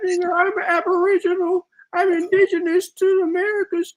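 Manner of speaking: mocking impression. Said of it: "that's exactly how he sounds".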